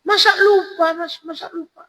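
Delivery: very high falsetto woman; high put-on female voice; woman's voice. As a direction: pitch way up as the woman answering. Voicing the woman in the story.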